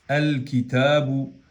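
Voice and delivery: "al kitabu" slow, L sounded before the k; lam pronounced. Shown as a demonstration. Teacher demonstrating the article before kaf, slowly pronounced lam. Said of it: "en este caso pronunciamos la lam de al".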